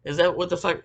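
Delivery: usual speaking voice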